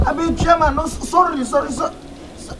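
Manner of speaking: high, stumbling sorry; stammering apology. Acted. Worker begging his chairman's pardon, stammering in fear. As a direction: trip over the apology in fear.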